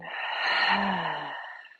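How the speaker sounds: long, falling, voiced sigh; big releasing exhale. Shown as a demonstration. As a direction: sigh it all out slowly and audibly. Models a deep sigh or yawn.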